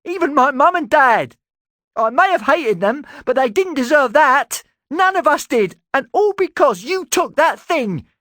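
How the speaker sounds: very high, loud, voice breaking with grief and rage; cracking with emotion. Acted. Talon grieving his parents, furious at Erasmus. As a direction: rage near tears. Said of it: "his voice cracked with emotion".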